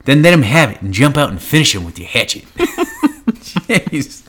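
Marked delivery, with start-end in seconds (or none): 0.0-2.4 high, loud prospector voice, laughing; old-timey prospector voice. Acted. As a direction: bark it like a grizzled old miner. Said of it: "in that prospector voice".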